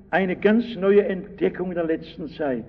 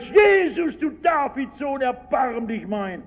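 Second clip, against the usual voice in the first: much higher pleading shout, slowing at the end; even louder cry; 7 semitones higher than usual. Retold or imitated. Blind beggar shouting over the crowd to Jesus, high pleading cry. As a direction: keep crying out, refuse to be silenced. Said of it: "Und der Blinde schreit".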